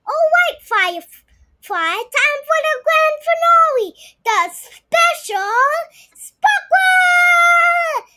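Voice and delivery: high, loud announcing shout; mom announcing. Acted. Mom calling the girls for the grand finale, loud and high.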